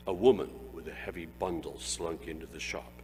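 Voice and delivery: plain reading voice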